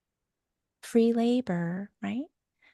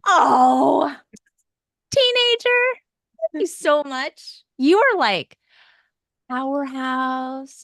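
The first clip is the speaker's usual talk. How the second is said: loud, very high over-the-top thanks; mock gushing adult; gushing praise; 6 semitones higher, 7 dB louder, slower than usual. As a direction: flatter the teen with hammed-up gratitude. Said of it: "you wanna be like".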